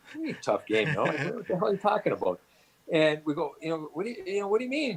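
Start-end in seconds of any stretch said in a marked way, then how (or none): 0.1-2.4 puzzled, rising to a higher pitch; baffled retort, acted out in the retelling; incredulous. Players at billet mother calling it tough, incredulous. 3.6-5.0 higher-pitched, puzzled questioning; baffled. Retold or imitated. Players asking billet mother to explain herself, baffled.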